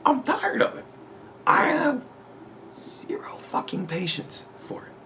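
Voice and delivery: strained, dropping into a whisper; exhausted patience. Real, not acted. Vlogger fed up after a long rant, strained near-whisper. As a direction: run out of voice and patience.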